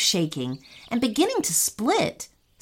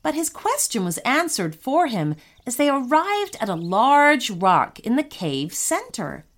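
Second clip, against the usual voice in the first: ordinary delivery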